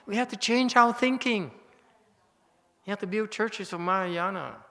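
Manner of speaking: we have to change our thinking, cried out fervently; excited preaching. Real, not acted. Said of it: "I get very emotional and very excited".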